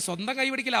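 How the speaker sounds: ordinary talk, nothing marked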